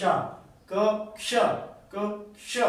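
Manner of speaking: क alone, then क्ष as "ksha"; sound-by-sound demonstration. Shown as a demonstration. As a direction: build क्ष from a separate k sound into k-sh. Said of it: "इस तरह से आपका sound आना चाहिए".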